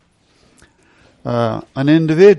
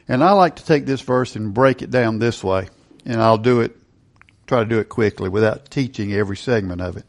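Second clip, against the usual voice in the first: ordinary delivery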